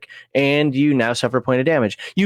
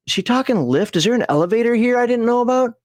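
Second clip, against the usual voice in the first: puzzled inner voice; 7 semitones higher than usual. Retold or imitated. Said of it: "so I'm thinking".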